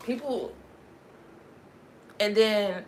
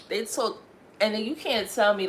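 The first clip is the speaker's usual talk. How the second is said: ordinary talk, nothing marked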